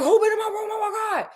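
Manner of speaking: high, squeaky panic; hammed-up high panicked voice; mock frantic voice. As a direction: squeal it like a frightened old man. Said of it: "so he was like".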